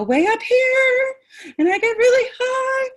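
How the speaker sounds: upset voice climbing into a high, loud squeal; very high pitched. Shown as a demonstration. Presenter demonstrating getting upset, very high pitched. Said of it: "when I start to get upset".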